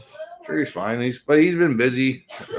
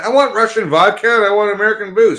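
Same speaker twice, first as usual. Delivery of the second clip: higher, louder hammed-up rant; mock outrage; 8 semitones higher, 5 dB louder than usual. Griping to friends about the booze ban, hammed-up anger. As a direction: rant like a fed-up customer, half joking.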